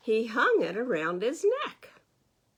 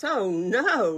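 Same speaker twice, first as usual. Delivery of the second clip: loud dismayed "oh no"; 9 dB louder than usual. Acted. Rabbit hears Goat ate his shamrocks, loud alarmed cry. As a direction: wail it out in alarm.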